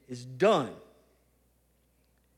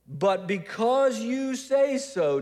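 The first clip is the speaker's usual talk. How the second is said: slow, higher, loudly stretched disclaimer; pointedly for the crowd; 6 semitones higher, 4 dB louder, slower than usual. Acted. Peter shifting responsibility to Jesus before the crowd, pointed. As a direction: lay it all on the other person, emphatically. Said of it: "he's looking at the crowd".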